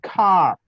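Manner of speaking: car drawn out with a curled English r. Shown as a demonstration. Teacher giving an English example, drawn-out English r in car. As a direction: hold the untrilled r at the end of car. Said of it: "cuando usted pronuncia esas palabras".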